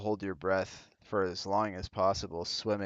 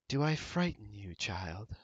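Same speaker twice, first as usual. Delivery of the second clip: mysterious goddess voice. Acted. GM voices the raven woman.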